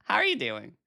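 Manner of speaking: ordinary talk, nothing marked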